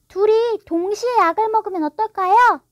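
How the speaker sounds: put-on high, loud child's voice; helpful girl. A narrator's reading. Wendy proposing both drink together, eagerly. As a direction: pipe up with a bright suggestion.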